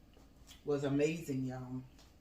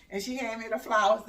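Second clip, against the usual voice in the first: ordinary delivery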